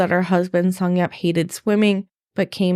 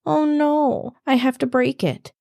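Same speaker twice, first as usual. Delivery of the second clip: mild high-pitched plea; lightly voiced begging, pitched up; 7 semitones higher than usual. A narrator's reading. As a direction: plead in a higher voice, understated. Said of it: "with a begging voice saying".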